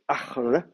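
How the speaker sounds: "Achl" as one syllable, guttural ch into l; no second vowel. Shown as a demonstration. Teacher showing the underlying form, "Achl" without a second vowel. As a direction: close the word "Achl" on bare consonants. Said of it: "normalement, il n'y a pas de voyelle".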